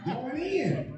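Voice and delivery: high mocking wife voice behind the door. Comic husband mimics wife shutting him out, high voice. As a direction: play the wife, high and dismissive.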